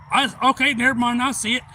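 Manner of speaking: loud, high, sudden realization; acted urgent spotting. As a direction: call it out as you spot the target. Owner spots alligator, about to shoot.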